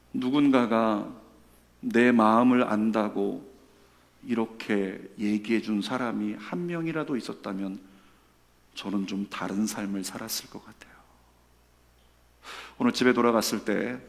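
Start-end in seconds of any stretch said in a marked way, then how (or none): 2.7-10.9 unvoiced whisper, softer and lower; whispered confession. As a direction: breathe it like a tearful secret. Deacon's tearful confession retold. (눈물 흘리며 고백하는 집사의 속삭임)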